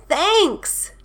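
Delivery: high, bright storybook exclamation; exclaimed thanks. A narrator's reading. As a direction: sing out thanks as the bear. Bear thanks Hare.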